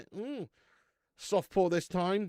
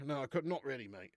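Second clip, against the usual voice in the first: usual speaking voice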